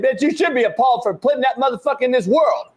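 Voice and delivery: high-pitched yelling rant, shouting at the mother in the story; real yelling rant, pitch way up; furious rant. Real, not acted. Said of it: "it wasn't part of the rant".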